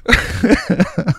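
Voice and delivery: high bubbling laugh; chuckle. Real, not acted. Storyteller amused by his younger self, chuckling. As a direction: chuckle before going on.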